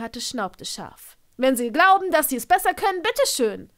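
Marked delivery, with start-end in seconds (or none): 1.4-3.7 loud, high indignant snap; insulted emergency doctor snapping back, loud and huffy; offended doctor. A narrator's reading. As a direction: throw the challenge back at him angrily.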